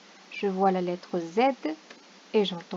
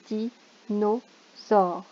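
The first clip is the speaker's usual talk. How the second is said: each syllable of Dinosaure spoken slowly apart; slow syllable sounding; much slower than usual. Shown as a demonstration. Teacher to pupil at home, slow syllable sounding. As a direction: stretch it into syllables. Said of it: "teacher sounds out word".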